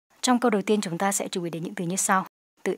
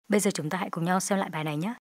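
normal talking voice

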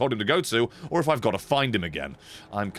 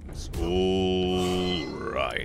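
drawn-out sing-song; 3 semitones lower, much slower than usual. Real, not acted. Drawn-out mock comfort.

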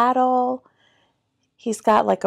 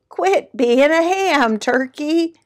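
bleating sheep; 7 semitones higher, 6 dB louder than usual. A narrator's reading. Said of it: "bleated Sheep".

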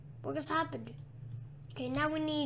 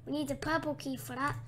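ordinary talk, nothing marked

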